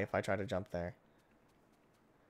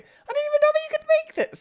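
much higher and louder, squeaky and amazed; squeaky surprise; 12 dB louder than usual. Acted. Gamer amazed that crafting exists, in a squeaky high shout. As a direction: shriek it out in a cartoon-high voice.

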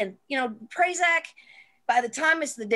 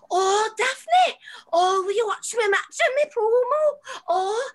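put-on very high, gushing accented voice; Nikki Storm impression; 13 semitones higher, 4 dB louder than usual. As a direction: plead eagerly in a hammed-up accent. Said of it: "That's a very good accent".

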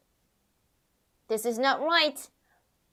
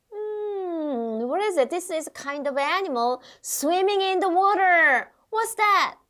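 quiz question; 7 semitones higher, much slower than usual. Shown as a demonstration.

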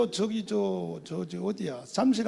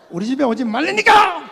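louder, sharp scolding retort; snapping at him; 6 semitones higher, 9 dB louder than usual. Retold or imitated. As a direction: snap at the unwanted suitor. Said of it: "그러면서 막 쏘지". (쏘아붙이는 말투)

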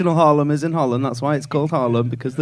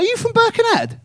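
much higher, laughing delight. Real, not acted. Comic excitedly guessing the man's hometown, laughing high. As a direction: squeal it out with glee.

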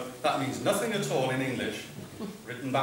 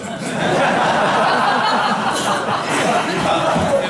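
big laugh; 8 semitones higher, 9 dB louder than usual. Real, not acted. Laughing at joke about humming.

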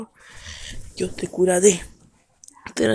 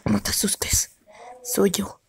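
breathy hushed whisper; slower than usual. Acted. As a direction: speak under your breath like a secret. Creator voice held in whisper.

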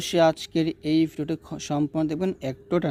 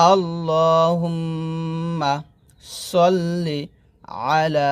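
drawn-out chanted Arabic; measured, sustained teaching recitation; slow recitation; 3 dB louder, much slower than usual. Acted. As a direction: chant slowly, humming the doubled m.

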